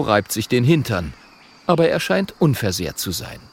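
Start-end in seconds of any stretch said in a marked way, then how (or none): none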